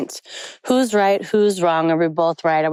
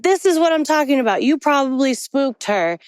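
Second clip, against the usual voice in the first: frustrated; 7 semitones higher than usual. Retold or imitated. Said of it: "mad, upset, frustrated".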